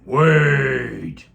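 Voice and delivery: loud, menacing stop; the stink bug interrupting the wedding, scary and loud. A narrator's reading. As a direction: boom it out to halt everything. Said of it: "said a scary voice".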